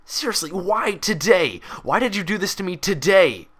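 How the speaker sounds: loud high wail of frustration; despairing outburst. A narrator's reading. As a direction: blame her for ruining his day. Orderly despairing at broken mirror.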